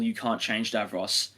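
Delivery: ordinary talk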